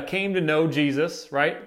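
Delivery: usual speaking voice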